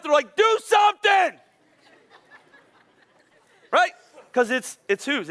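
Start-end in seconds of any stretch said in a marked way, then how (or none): 0.3-1.4 high, slow, shouted plea; freaking-out kid. Retold or imitated. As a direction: yell it like a panicked child. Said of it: "They're freaking out".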